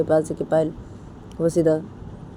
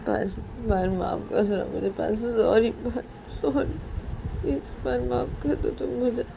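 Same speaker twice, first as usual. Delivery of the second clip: soft, high, pleading voice; pleading apology; 5 semitones higher, 5 dB quieter than usual. Acted. Poonam begs Payal to forgive her, pleading softly. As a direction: beg forgiveness softly, pitched up.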